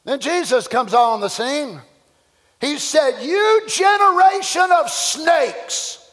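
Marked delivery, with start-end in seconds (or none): 3.2-6.0 high, slow, shouted rebuke; rebuking shout. Retold or imitated. Jesus rebukes the preachers with a high, slow shout. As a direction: thunder it down on the hypocrites.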